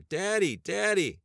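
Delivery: put-on little girl crying out for her father. A narrator's reading. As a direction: call for daddy in terror. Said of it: "The little girl cried out for her father".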